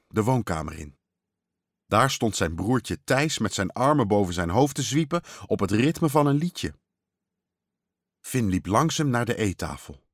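plain reading voice

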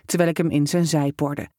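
usual speaking voice

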